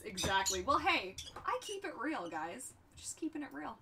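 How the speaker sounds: usual speaking voice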